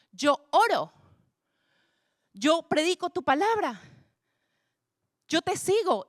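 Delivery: high, tearful, pleading cry; anguished plea to God. Retold or imitated. Son crying to God outside his father's hospital room, high anguished plea. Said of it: "y le decía".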